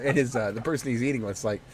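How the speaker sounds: ordinary talk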